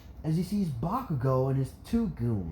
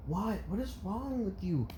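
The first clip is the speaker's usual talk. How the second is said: higher, softer voice of shocked reproach; shocked mother; 6 semitones higher, 6 dB quieter than usual. Acted. Inko to her husband, shocked reproach. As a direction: question him in dismay. Mother confronts swearing father.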